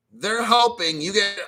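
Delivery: normal talking voice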